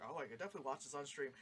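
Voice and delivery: low, soft and quick; fast, quiet reassurance to oneself; retold casual relief. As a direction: toss it off quickly under the voice. Retelling relieved aside to self.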